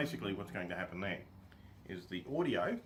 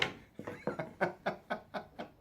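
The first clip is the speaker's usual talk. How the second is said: laughter; 9 semitones higher than usual. Real, not acted. Laughing at his own mistake.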